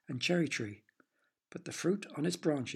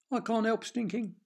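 a hint of a sorry vegetable, higher; 8 semitones higher, 3 dB louder than usual. A narrator's reading. The stinky vegetable speaks.

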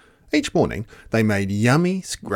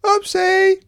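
put-on very high, louder little-girl oops; giggly oopsie; 6 dB louder than usual. Goldilocks breaks the chair, high and giggly. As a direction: sing it out like a caught child. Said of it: "giggled Goldilocks".

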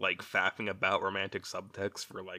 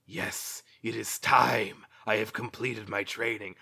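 mock ninja. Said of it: "she's like".

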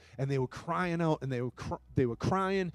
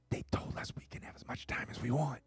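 hushed, whispered aside; retold whisper; 11 dB quieter than usual. Colleague passing on news to the preacher, whispered. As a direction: whisper it like news passed on in secret.

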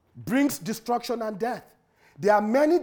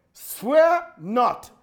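ordinary talk, nothing marked

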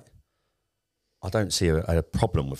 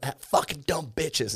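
slow, high mock Tate drawl; imitating Andrew Tate; 8 semitones higher, slower than usual. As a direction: ham up a cocky Tate line. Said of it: "Andrew Tate's like".

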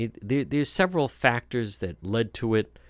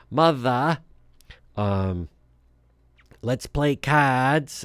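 mock Boston Brahmin Kennedy accent, higher, slow and drawn-out; old Kennedy accent; 4 semitones higher, 4 dB louder, much slower than usual. Host mocks the governor's mother in an old Kennedy accent. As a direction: send up the governor's mother with a plummy New England Kennedy accent. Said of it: "kind of like the old Kennedy accent".

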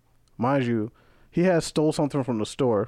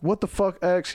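retold indignant demand, pitched up; higher-pitched mock angry challenge; pissed-off friend; 7 semitones higher than usual. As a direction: imitate a friend who's mildly pissed. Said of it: "He got pissed at me".

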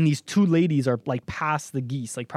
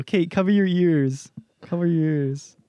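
usual speaking voice

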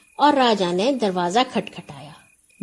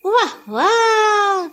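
slow, high giggling wah wah; 9 semitones higher, much slower than usual. A narrator's reading. Nora giggles over the sheep trade. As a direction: giggle with glee. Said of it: "نورا کھلکھلا کر بولی".

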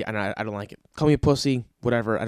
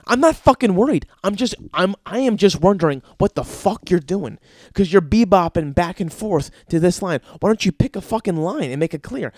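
high, loud angry ranting; furious rant; 5 semitones higher, 6 dB louder than usual. Acted. Comedian keeps ranting at the guy, high and loud. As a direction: keep hammering him, fed up.

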